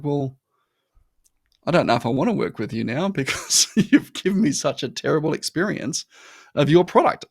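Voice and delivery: a touch of mock exasperation, rising; half-laughing, fed up with the company; exasperated retort. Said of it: "I'm like".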